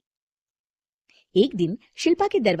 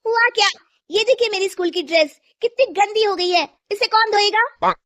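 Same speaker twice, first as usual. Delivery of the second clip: put-on much higher, loud child's complaint; a spoiled girl throwing a tantrum; whiny higher child voice; bratty daughter; 12 semitones higher, 8 dB louder than usual. Daughter demands new uniform.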